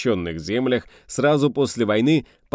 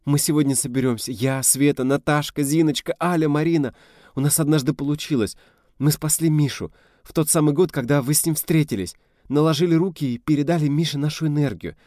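put-on young woman pleading; 6 semitones higher than usual. A narrator's reading. Rita voiced continuing plea.

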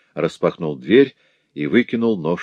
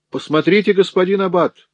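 much higher, exclaiming call to the priest; shouting; 12 semitones higher, 3 dB louder than usual. Acted. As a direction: cry out in false outrage. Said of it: "закричал он".

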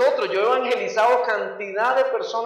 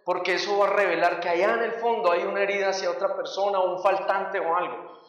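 normal talking voice

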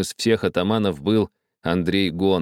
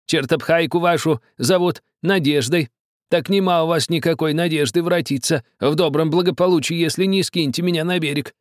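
gloating threat; 10 semitones higher than usual. A narrator's reading. Old man gloating over the captain. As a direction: savour the threat. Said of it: "злорадно спросил старик".

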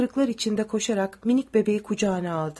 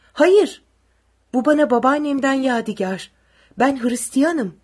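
desperate denial; 4 semitones higher, 6 dB louder than usual. A narrator's reading. Woman protests soldier's accusation.

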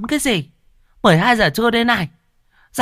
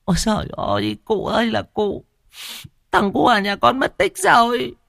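a mother begging through sobs; wailing mother. Acted. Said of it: "Mụ Hoa mới mếu máo". (mụ Hoa mếu máo, kêu khóc van xin cụ Long)

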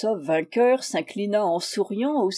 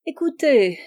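high, louder coaxing plea; put-on sweet, pitched up; wheedling; 6 semitones higher, 6 dB louder than usual. A narrator's reading. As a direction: call him back cajolingly. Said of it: "fit-elle d'un accent câlin".

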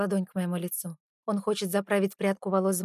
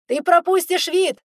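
high loud slow shout; shouting over wind; 9 semitones higher, 10 dB louder, slower than usual. A narrator's reading. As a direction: yell it over the rushing wind. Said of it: "перекрикивая ветер".

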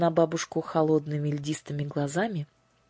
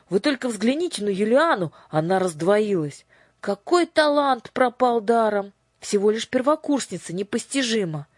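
amazed; 6 semitones higher, 5 dB louder than usual. A narrator's reading. Crowd marvels aloud.

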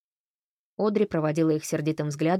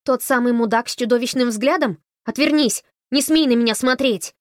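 loud, higher furious shout; angry yell; 5 semitones higher, 7 dB louder than usual. A narrator's reading. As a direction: scream at him to turn away. Girl yells at the man.

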